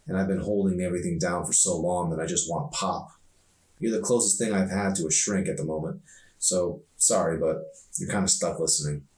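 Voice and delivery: ordinary delivery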